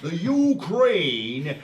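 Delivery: put-on loud, very drawn-out American accent. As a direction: stretch it out like a hammy American. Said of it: "do my American accent now".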